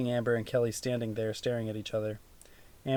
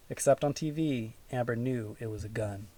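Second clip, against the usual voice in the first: ordinary delivery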